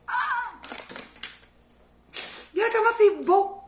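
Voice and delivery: high, bright exclamation of delight; excited delight. Real, not acted. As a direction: gush over how pretty it is. Admires the finished pizza. (émerveillement très aigu)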